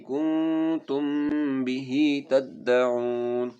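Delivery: melodic chanted tajweed recitation; Quran recitation. Shown as a demonstration. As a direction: recite slowly and musically, stretching vowels.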